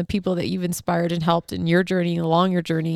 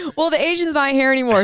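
laughing; 6 semitones higher than usual. Real, not acted. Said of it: "laughing while saying".

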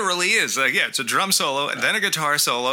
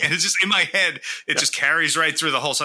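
ordinary talk, nothing marked

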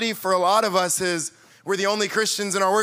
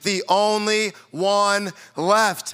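very slow, drawn-out mope; mock self-pity; much slower than usual. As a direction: stretch every word like a sulking prophet. Elijah self-pity quoted.

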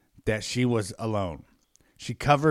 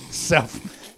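ordinary talk

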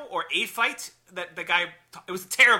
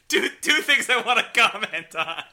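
higher, struggling to talk through laughter; laughing; 4 semitones higher than usual. Real, not acted. Trying to resume the discussion after a joke, laughing. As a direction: get the point out while cracking up.

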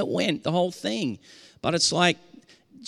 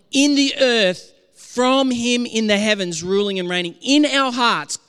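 ordinary talk

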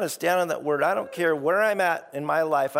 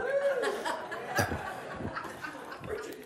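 high laugh; 8 dB quieter than usual. Real, not acted. Laughs at own voice.

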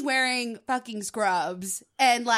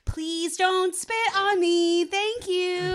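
teacher voice; 5 semitones higher than usual. Shown as a demonstration. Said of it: "I got my teacher voice".

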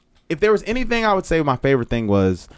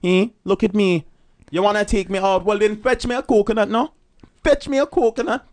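higher-pitched Jamaican patois impression; animated Jamaican accent, pitched up; 7 semitones higher than usual. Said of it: "the Jamaican accent".

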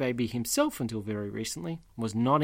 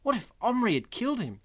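acted rising worry, higher pitch; higher-pitched, mildly panicked storybook reading; distressed; 8 semitones higher than usual. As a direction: read it as anxious what-ifs piling up. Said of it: "He's very distressed at that point".

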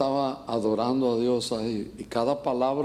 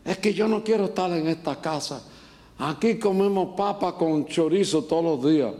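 higher-pitched mock grumbling child; complaining son; 4 semitones higher than usual. Ungrateful son complaining to mother about food, higher-pitched whine. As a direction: play an ungrateful kid complaining. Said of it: "Cuando un hijo le dice".

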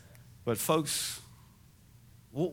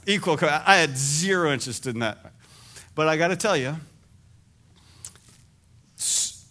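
ordinary delivery

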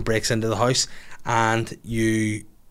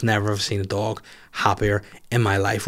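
normal talking voice

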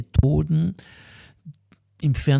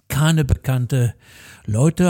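ordinary delivery

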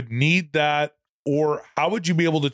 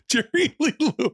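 laughing disbelief; 11 semitones higher than usual. Real, not acted. Co-host laughs at his friend's name mix-up in disbelief. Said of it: "laughing at the wrong name".

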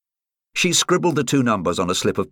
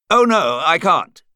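louder, higher insistent caller; 9 semitones higher, 6 dB louder than usual. A narrator's reading. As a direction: refuse firmly down the phone, pitched up. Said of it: "The voice at the end of the line was insistent".

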